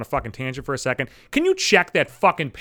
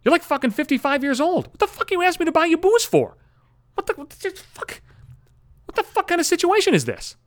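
much higher, shrill-edged disbelief rising to a yell; high incredulous rant at the woman; incredulous outburst; 12 semitones higher than usual. Retold or imitated. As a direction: escalate into outraged disbelief. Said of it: "I'm thinking to myself".

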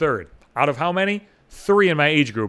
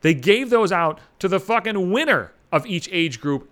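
usual speaking voice

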